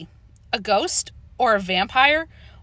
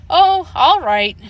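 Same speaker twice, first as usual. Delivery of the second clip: slow, louder, higher weary sigh; reluctant giving in; 7 semitones higher, 6 dB louder, slower than usual. A narrator's reading. Mother gives in to daughter's pleading, slow weary sigh. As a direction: give in slowly, long-suffering.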